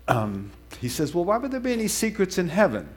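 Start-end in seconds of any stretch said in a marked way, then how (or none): 1.1-2.9 quick, higher-pitched puzzled question; lightly voiced curious newcomer, asking eagerly; wondering. A narrator's reading. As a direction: wonder aloud, pitch lifted. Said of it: "Mister Jones wonders".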